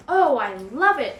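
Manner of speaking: a fox exclaiming with delight at his new tooth; exclaimed. A narrator's reading. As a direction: gush over the gold tooth. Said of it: "exclaimed the fox".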